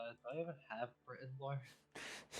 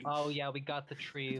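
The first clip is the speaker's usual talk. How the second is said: loose, jokey Boston accent, a bit louder; 2 semitones higher, 4 dB louder than usual. Shown as a demonstration. Player trying out the character's Boston accent for friends. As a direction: lay on a playful Boston accent. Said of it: "a Boston accent".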